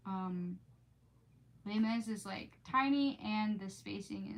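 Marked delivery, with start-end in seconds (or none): none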